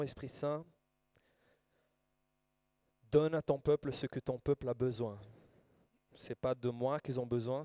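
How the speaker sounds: prière intime et plus douce; adresse-toi doucement à Dieu, humblement. Acted. Prays before preaching. (prière humble et douce avant la prédication)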